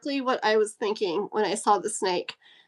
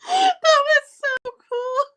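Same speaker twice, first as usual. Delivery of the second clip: high squeal of delight, laughing; squealing with joy; 7 dB louder, slower than usual. Real, not acted. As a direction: squeal it out, laughing and near tears. Overjoyed by gifts.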